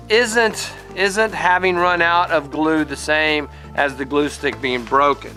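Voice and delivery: usual speaking voice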